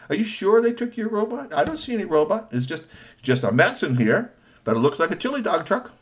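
put-on high, bright-eyed kid questioning; high child voice. Three T asking the officer, high child voice.

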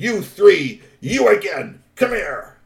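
high, loud stern call; stern summons. A narrator's reading. Troll father summoning the three teens, loud stern call. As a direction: call the culprits over sharply.

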